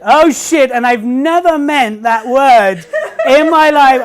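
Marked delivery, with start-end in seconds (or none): 0.0-0.7 laughing curse. Retold or imitated. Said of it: "what my line was".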